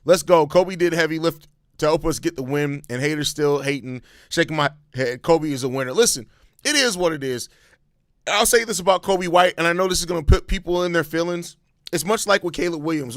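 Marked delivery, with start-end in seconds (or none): none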